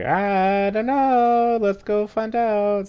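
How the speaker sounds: high, slow, innocent act; nervous squeak. Retold or imitated. Teen dodging mom's question, slow high fake innocence. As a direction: play dumb in a high squeak.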